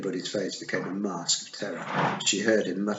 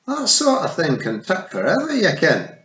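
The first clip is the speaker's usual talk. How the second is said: Scottish Feegle; 9 semitones higher, 8 dB louder than usual. A narrator's reading.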